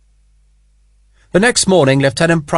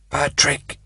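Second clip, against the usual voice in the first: breathy, barely voiced name; faint whisper; 2 semitones higher, 7 dB quieter than usual. A narrator's reading. Wounded Sharp telling Connolly his name, faint. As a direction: whisper it through the pain.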